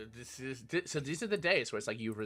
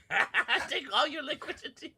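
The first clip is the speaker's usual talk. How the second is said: laughing; 3 dB louder than usual. Real, not acted. Laughing while speaking.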